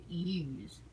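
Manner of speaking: "yous" drawn out slowly as one syllable. Shown as a demonstration. Mocking northerners saying yous, slow. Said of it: "the people who say yous".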